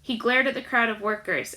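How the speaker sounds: plain reading voice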